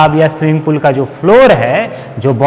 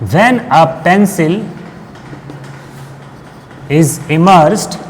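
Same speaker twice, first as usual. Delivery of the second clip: drawn-out phrases with long gaps; slow enough to write down; slow dictation; 5 dB quieter, much slower than usual. Real, not acted. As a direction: dictate it piece by piece. Teacher dictates second example for notes.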